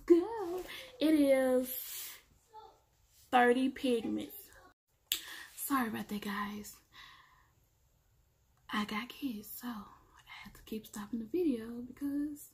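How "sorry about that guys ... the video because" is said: hushed apology; whispered apology to viewers. Real, not acted. Apologizing for interruptions.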